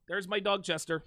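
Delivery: ordinary talk, nothing marked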